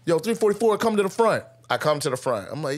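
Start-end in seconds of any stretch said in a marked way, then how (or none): 0.0-1.4 sergeant calling out. Retold or imitated. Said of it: "sergeant calls for him".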